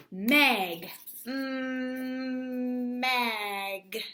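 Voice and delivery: Mag stretched, mmm held long, mag again; sounding out. Shown as a demonstration. Teacher sounds out first syllable for her class, stretched. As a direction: hum the m, then say mag. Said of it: "four word parts that I can really focus in on".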